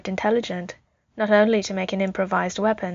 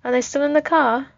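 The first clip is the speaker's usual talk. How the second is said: put-on small child's higher-pitched question; little boy; 6 semitones higher, 3 dB louder than usual. A narrator's reading. Tony asks the soldier about his parents, childlike and high. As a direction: ask it as a young boy, voice lifted.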